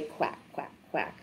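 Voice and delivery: whispered quack, quack, quack; very soft, toneless duck sounds; hushed quacks. Acted. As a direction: drop to a low, voiceless murmur. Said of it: "Ducks say".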